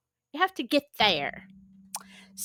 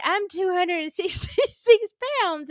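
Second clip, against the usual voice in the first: laughing through quote; 7 semitones higher than usual. Retold or imitated. Retelling the woman's line, laughing.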